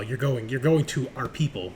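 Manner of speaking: ordinary delivery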